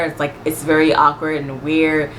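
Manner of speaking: normal talking voice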